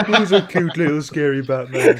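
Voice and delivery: put-on laughing, loud, high cooing baby talk; mock doting voice through laughter. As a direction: coo at him like a pet while laughing. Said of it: "That's what Alfred would say".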